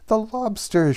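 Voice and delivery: high excited shout of the Gryphon. Acted. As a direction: leap up and shout it out. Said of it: "shouted the Gryphon".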